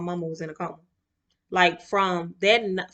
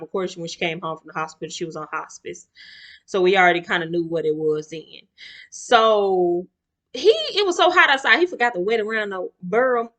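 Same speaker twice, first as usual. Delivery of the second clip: ordinary talk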